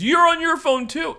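high, loud indignant snap; mock mad retort. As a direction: throw it back at her, offended. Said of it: "And then I get mad because".

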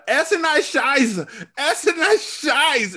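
"Essen I Scheiße" said slowly, high, laughing; "Essen I Scheiße" with a German-ish Scheiße /ʃaɪz/; German pronunciation. Retold or imitated. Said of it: "is how they say it in German".

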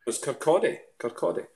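"Kirkcaldy" as kir-KAW-day, silent L; "Kirkcaldy" with an AW and no L, correcting someone; correcting pronunciation. Shown as a demonstration. Correcting the mispronounced town name.

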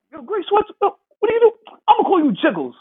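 high, teasing taunt voice; imitating an older player mocking. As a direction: rib him with a high squeaky tease. Voicing the older guy's taunt.

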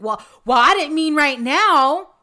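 hammed-up stammering excuse; doctor caught out, mock flustered and loud.

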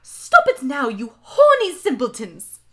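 loud, scandalized professor's shout; full-volume telling-off. A narrator's reading. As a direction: shout it like an outraged teacher. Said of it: "shouted Professor McGoogle".

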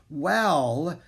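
lightly winded, drawn-out hesitation; struggling to breathe. A narrator's reading. Annie just fell off the horse, slightly breathless. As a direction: start haltingly, a touch out of breath. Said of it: "still struggling to breathe".

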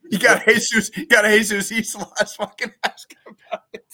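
loud, high, laughing through words. Real, not acted. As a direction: crack up while talking. Laughing hard while talking.

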